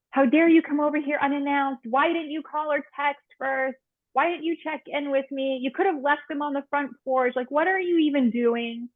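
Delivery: retold indignant scolding. Telling off a friend who dropped by unannounced, retold indignant scolding. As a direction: scold a friend in a high, affronted voice. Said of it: "my reaction was to yell at them".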